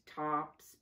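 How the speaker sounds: ordinary delivery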